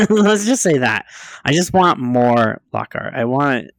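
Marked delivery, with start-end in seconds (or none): 0.1-1.0 higher-pitched, through a laugh. Real, not acted. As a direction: laugh your way through the words. Laughs at own blunt statement.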